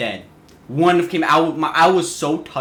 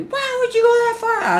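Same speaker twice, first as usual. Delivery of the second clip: much higher, shrill mock scolding; imitating mom screaming. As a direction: play the mom yelling at her son. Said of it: "Screaming at me".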